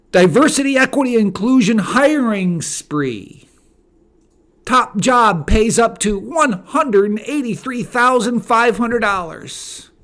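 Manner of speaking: loud, high-pitched headline announcement; blaring headline proclamation; headline read. Acted. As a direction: belt out the headline to show it is outrageous. Reads out an outrageous headline.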